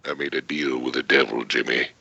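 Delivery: low, soft, slow menacing line; deep villain voice. Shown as a demonstration. Actor leaning into a fan's ear, deep soft villain. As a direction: lean in and breathe the threat slowly.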